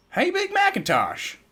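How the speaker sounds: high, loud holler. A narrator's reading. Applejack hollering to Big Mac upstairs, high and loud. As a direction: call out across the house. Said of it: "Applejack hollered".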